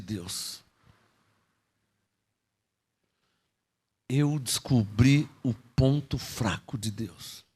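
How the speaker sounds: dramatic hushed disclosure; hushed revelation. Acted. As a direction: slowly confide a secret to the church.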